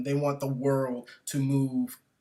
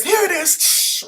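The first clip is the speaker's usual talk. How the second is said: high, loud, slow retort; angry barista; 12 dB louder, slower than usual. Retold or imitated. Barista snaps back at the rude customer. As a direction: spit it out while throwing the cup.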